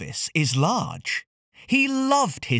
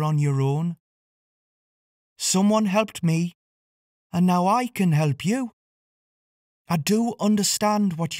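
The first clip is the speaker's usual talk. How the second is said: higher, kind voice with a hint of tears; tearful Cilla; 4 semitones higher than usual. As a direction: share a sad past to comfort him. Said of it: "with tears in her eyes, she started to speak".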